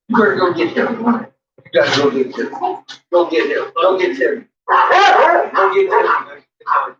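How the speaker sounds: high, building to loud; talking to a dog. Real, not acted. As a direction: egg the dog on, rising. Urging the dogs to fetch Timmy.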